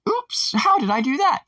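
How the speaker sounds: very high mock-innocent squeak; feigned surprise. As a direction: play clueless in a squeaky put-on voice.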